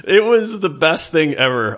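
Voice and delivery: usual speaking voice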